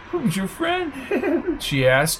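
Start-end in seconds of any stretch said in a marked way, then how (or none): 0.1-0.9 high, soft squeaky coo. A narrator's reading. Flo's squeaky flirty question. 1.0-1.5 soft high titter; squeaky giggle. A narrator's reading. Flo giggles.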